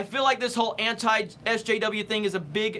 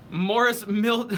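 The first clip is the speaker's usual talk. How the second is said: ordinary delivery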